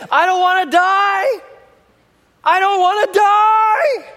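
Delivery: put-on high, loud, panicked child's cry, slowing down; child screaming. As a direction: wail it like a terrified little girl. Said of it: "she's just screaming out".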